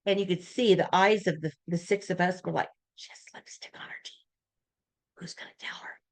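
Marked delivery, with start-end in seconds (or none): 3.0-6.0 hushed whisper among friends. Retold or imitated. Women whispering about lipstick on the boss's teeth, hushed. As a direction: whisper it so she can't hear. Everyone silently noticing lipstick.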